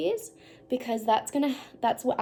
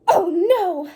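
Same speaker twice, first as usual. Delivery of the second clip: worried gasp; 6 semitones higher, 9 dB louder than usual. Shown as a demonstration. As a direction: gasp then loud alarmed oh no. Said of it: "Help me read that again".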